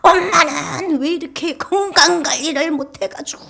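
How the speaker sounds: high, loud imitation of a nagging daughter; scolding daughter. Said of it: "책망 비슷하게 하더라고요".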